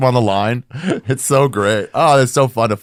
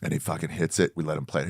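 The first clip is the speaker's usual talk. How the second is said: usual speaking voice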